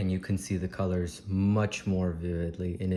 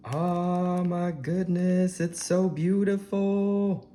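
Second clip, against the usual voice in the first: much higher, slow, marvelling; awestruck; 10 semitones higher, 4 dB louder, much slower than usual. Real, not acted. Vlogger seeing the inside of the cacao fruit, high awestruck wonder. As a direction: gasp it out in wonder.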